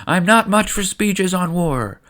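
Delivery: half-hearted queen's rallying shout; rallying yell. Acted. Queen rallying troops before battle, with a mild mock yell. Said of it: "stands alongside Boss Nass and yells".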